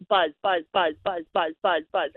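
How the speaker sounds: low buzzing mimic of a vibrating phone; imitated phone buzz. Imitating her phone buzzing at three in the morning, low mock buzz.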